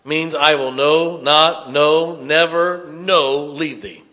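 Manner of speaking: "no, not, no, never, no" spaced and slowed; deliberate pounding emphasis; emphatic negatives. Shown as a demonstration. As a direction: lean hard on each negative, slowing in the middle. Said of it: "Used for emphasis".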